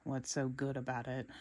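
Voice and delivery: low, soft, glum grumble; mumbled Squidward. A narrator's reading. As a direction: mutter it like a grouchy neighbour. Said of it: "Squidward mumbled".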